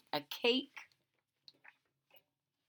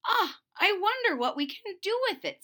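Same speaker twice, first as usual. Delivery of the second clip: much higher, louder wondering wife; wife's voice; 12 semitones higher, 5 dB louder than usual. A narrator's reading. Wife wondering about the pot, higher voiced. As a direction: lift into the wife's voice.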